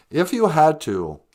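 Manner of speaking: usual speaking voice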